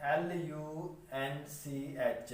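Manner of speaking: letter by letter, drawn out for students; slow spelling. Shown as a demonstration. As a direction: spell each letter very slowly. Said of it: "अब मेरे पास जो word है वो है लंच". (अक्षर-अक्षर धीरे-धीरे)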